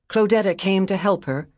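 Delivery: ordinary delivery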